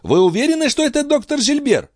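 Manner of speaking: very high, urgent question; double-check in agitation; incredulous query. A narrator's reading. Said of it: "переспросила королева". (взволнованно переспросить)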